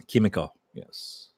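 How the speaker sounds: lightly put-on French accent; mock French pronunciation of Kimiko; bad French accent. Shown as a demonstration. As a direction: say the name as a Frenchman might, badly. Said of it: "My really bad French accent".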